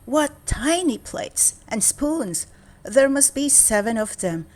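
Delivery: put-on a little girl marvelling at tiny plates. A narrator's reading.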